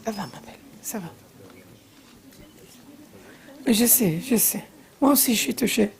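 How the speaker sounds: comforting en français, tout doux; soft comforting. Real, not acted. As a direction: hush her gently as she cries. Comforting a weeping guest.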